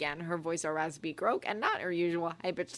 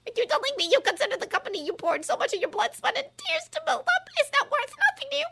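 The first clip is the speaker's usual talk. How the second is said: outraged disbelief; 6 dB louder, faster than usual. Acted.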